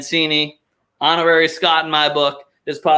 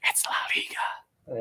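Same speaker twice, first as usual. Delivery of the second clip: hushed whisper, fading lower; dramatic whisper; 6 semitones lower, 13 dB quieter, slower than usual. Acted. Host sells La Liga to listeners, dramatic whisper. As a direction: breathe it like a secret tagline. Dramatic tagline about Spanish football.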